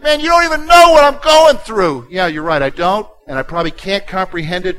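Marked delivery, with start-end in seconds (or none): 0.0-2.1 exasperated churchgoer. Retold or imitated. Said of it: "and you're like".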